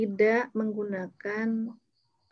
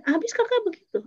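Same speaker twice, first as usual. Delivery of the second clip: put-on a kid blaming her little sister; whiny child; 10 semitones higher than usual. Older niece whining to her aunt about her sister, high-pitched.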